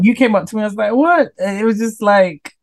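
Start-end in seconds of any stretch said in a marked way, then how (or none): 0.9-1.3 high, sharp burst of disbelief; a startled what pitched way up; retold double take; surprised what. Retold shock on meeting him.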